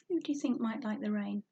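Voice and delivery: usual speaking voice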